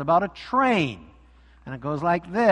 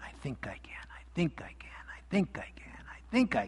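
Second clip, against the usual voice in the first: whispered, much softer chant; straining whisper; 2 semitones lower, 12 dB quieter than usual. Acted. Little engine climbing the steep mountain, whispering. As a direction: breathe it out, straining uphill.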